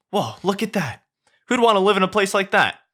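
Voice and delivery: high, excited chattering donkey. Acted. Donkey flatters Shrek in a high excited voice. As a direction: gush at him, high and eager.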